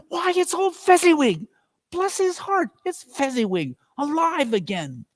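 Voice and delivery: put-on overjoyed at seeing his old master; great excitement. A narrator's reading. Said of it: "cried out in great excitement".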